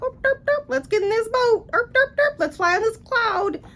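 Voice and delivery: way up high, goofy derp-derp voice; dopey mock voice. Mocking the carefree couple in a high dopey voice. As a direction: play the couple as clueless airheads, squeaky. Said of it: "They're just like".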